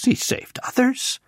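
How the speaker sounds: high, sneering priest's jibe; mocking. A narrator's reading. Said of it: "mocked him among themselves".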